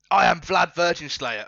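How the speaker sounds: villain introduction. Acted. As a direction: proclaim your name like a hammy overlord. Villain announces himself.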